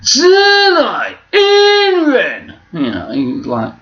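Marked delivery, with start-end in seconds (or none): 0.0-2.5 very high, loud, stretched-out announcement; slow game-show style presenter, hanging on each word; Jeremy Clarkson. Shown as a demonstration. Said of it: "he almost sounds like Bullseye".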